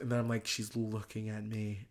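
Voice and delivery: ordinary delivery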